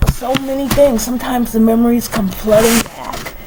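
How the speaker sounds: ordinary talk, nothing marked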